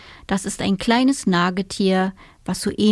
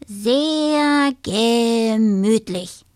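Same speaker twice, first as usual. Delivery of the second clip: slow, satisfied little voice; contented little dormouse; 3 semitones higher, much slower than usual. A narrator's reading. The dormouse tests his bed, slow and contented. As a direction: savour the comfort slowly. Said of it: "fand er".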